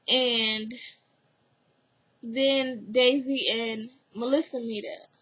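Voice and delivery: ordinary delivery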